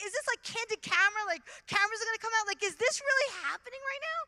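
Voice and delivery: can this be real; giddy disbelief; incredulous. Retold or imitated. Incredulous inner thought.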